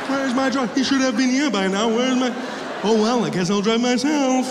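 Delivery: stagey mock indignation for onlookers; pretending it was planned. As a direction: act like someone expecting a chauffeur. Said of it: "I act like I planned it all".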